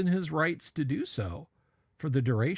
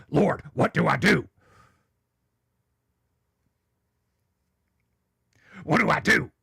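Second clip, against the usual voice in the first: a hint of a desperate cry to the Lord, louder; Alex Jones pleading; 6 dB louder than usual. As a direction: plead with heaven in mock panic. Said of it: "I don't bust out the Alex Jones".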